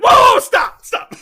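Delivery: high, loud mock shout of whoa, stop; shrill comedic panic shout; hammed-up panicked yell. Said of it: "and he's like".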